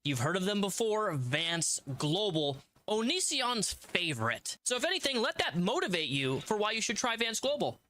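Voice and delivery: hammed-up sponsor pitch; upbeat salesman voice; ad read.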